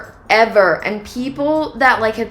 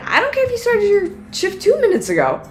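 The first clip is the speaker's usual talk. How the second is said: much higher, exasperated inner voice; thinking it in higher-pitched indignation; indignant thought; 12 semitones higher than usual. Retold or imitated. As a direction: let the irritation spike in your head. Inner thought at rude worker.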